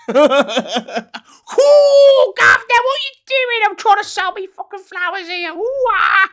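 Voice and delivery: put-on squealing, very high cockney flower girl, laughing; mock Audrey Hepburn cockney. Mocking Audrey Hepburn's My Fair Lady accent, shrill cockney. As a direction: shriek it as an overdone cockney flower seller. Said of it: "her accent at the beginning of fucking My Fair Lady".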